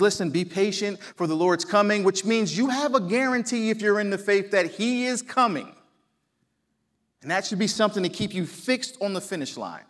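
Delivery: normal talking voice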